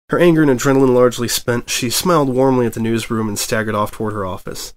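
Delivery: ordinary delivery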